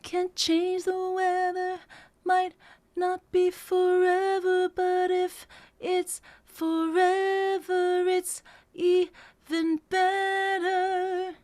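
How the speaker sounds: sung very slowly; slowed down. Shown as a demonstration. Coach slowing it down to sing along. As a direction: stretch every word out. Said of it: "let me slow that down".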